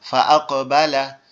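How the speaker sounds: slow "আকবালা" with a bouncing echo on the ক; qalqala echo. Shown as a demonstration. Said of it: "একটা হরফ double উচ্চারিত হয়". ("আকবালা"-র ক-এ কলকলা করে ডাবল উচ্চারণ)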